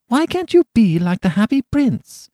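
high, scolding mother's voice; exasperated mother. A narrator's reading. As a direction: chide a crying child in a high pitch. Mother scolding crying little boy.

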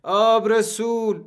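high, long-drawn anguished call; pleading wail rising over the battle; wailing cry. A narrator's reading. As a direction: wail it out slowly, stretching each word. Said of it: "یکی زجه زد".

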